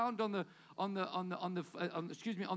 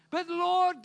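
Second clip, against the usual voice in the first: protesting objection; 8 semitones higher, 8 dB louder than usual. Acted. Gideon objects to God.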